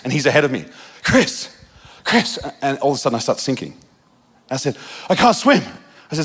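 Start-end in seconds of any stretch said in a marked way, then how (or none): 1.0-2.4 higher calls across the water; a retold, half-voiced cry for help; calling out for help. Sinking, calling to mentor ahead. 5.1-5.6 higher, urgent retold alarm; a retold panic, lightly voiced; panicked cry. Sinking in the lake.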